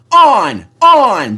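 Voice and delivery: high, loud push; yelling trucks. A narrator's reading. The trucks yell to push on, high and loud. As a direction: drive them on. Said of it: "they yelled".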